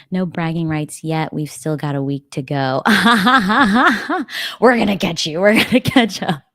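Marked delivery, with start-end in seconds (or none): 2.9-4.5 loud, high, staccato villain cackle; gloating mock laugh. Playful taunt at rival team. 4.6-6.4 loud, higher-pitched mock threat; playful taunt. Playful taunt at rival team.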